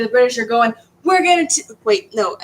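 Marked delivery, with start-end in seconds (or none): 1.0-1.6 raised, higher-pitched mock yell. Teacher acting out British soldiers yelling at colonists, mock yell without accent. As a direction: yell it across the field, half-serious. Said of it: "They're yelling at each other".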